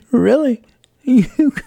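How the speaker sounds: high, squeaky burst of laughing disbelief; stunned disbelief. Retold or imitated. Told the landlord wants to sell, laughing in high-pitched disbelief. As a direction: laugh out a shocked "really?" at unbelievable news. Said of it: "I'm like".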